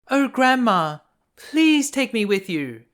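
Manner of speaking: high, loud desperate plea; pleading girl. A narrator's reading. Freezing girl begging her grandmother, high and desperate. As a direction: beg as if she might vanish. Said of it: "said little Gretchen".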